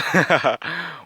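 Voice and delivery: high burst of laughter; real laughter. Real, not acted. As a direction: crack up at your own advice. Laughs at own advice.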